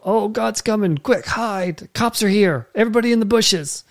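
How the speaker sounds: high-pitched, hammed-up alarm; mock panic. Preacher spoofing Adam and Eve hiding from God, in mock panic. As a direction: play a comic lookout warning the gang.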